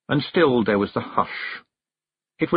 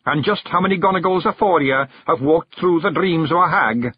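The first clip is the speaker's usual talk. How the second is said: higher Scots brogue, pressing questions; Rob, puzzled Scots chief; 7 semitones higher, 4 dB louder than usual. A narrator's reading. Rob presses young Billy about the strange horse, urgent Scots voice. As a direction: demand an answer from the lad.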